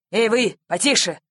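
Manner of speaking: shouted order. A narrator's reading. Policeman silencing the muttering bandits, calling out. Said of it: "крикнул полицейский".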